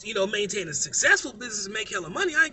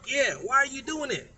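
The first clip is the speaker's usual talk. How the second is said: usual speaking voice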